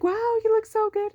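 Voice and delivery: high, loud gushing compliment, retold; happy admiration. Assistant admiring her returning student, very happy. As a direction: imitate a delighted, doting welcome. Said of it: "she was so happy to see me".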